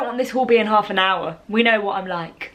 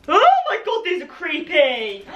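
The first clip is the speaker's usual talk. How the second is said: high excited squeal; excited shriek; 6 semitones higher than usual. Real, not acted. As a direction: squeal with delight. Sees fangs, shrieks.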